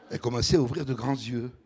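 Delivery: usual speaking voice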